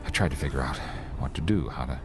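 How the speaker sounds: low, weary storytelling; story narration. A narrator's reading. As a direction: recount the dread, subdued. Narrator retells lonely ordeal.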